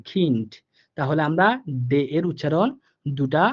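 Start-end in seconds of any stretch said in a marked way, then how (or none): 0.1-0.5 খিন্ড ending in a light t, not a full d; final d as light t. Shown as a demonstration. Teacher showing students how খিন্ড ends, light t. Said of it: "হালকা ট এর মতো উচ্চারণ হবে".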